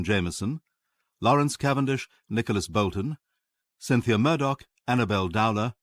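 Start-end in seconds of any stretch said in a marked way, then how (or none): none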